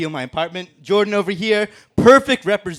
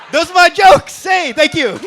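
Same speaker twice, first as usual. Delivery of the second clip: loud demand; 10 semitones higher, 8 dB louder than usual. Real, not acted. Comic demands applause.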